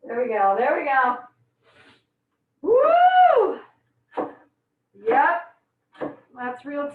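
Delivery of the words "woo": high, loud, held "woo"; long piercing whoop of relief from fumes. Real, not acted. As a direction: whoop out the onion sting. Reacting to onion fumes.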